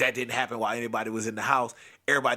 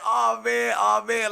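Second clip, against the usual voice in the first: dread; 8 semitones higher, 4 dB louder, slower than usual. Retold or imitated. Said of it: "I'm over there like".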